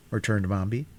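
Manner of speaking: ordinary delivery, nothing marked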